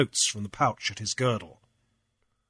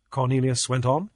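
usual speaking voice

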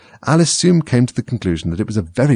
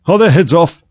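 high, loud imperious shout; shouted question; 9 semitones higher, 6 dB louder than usual. A narrator's reading. Queen checks on the executions, shouting. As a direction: shout it out demandingly. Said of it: "shouted the Queen".

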